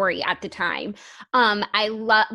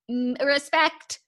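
slow drawn-out respect, pitched up; mock solemn respect; 5 semitones higher, much slower than usual. Joking with cohost about twenty-year marriages, mock solemn. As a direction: savor it like a toast.

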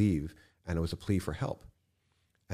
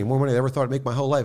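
ordinary talk, nothing marked

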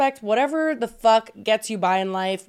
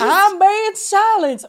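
high, loud, very slow mocking complaint; mock whine; 9 semitones higher, 6 dB louder, much slower than usual. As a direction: drag it out like a dramatic victim. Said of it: "be quiet?".

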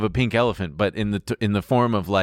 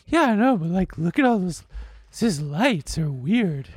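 imitating weird date; 9 semitones higher than usual. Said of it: "this exactly how she sounds".

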